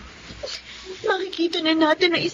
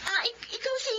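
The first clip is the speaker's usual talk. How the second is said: tearful; 7 semitones higher, 10 dB quieter than usual. Acted. Meeting long-lost cousin.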